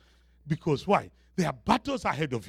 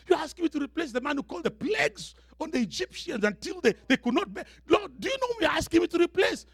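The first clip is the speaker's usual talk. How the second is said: high, agitated protest; overwhelmed Joshua; 9 semitones higher than usual. Acted. Joshua asking God how he could replace Moses, overwhelmed. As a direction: plead in disbelief, higher and faster. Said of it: "So Joshua must be wondering".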